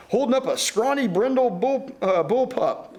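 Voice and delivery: ordinary talk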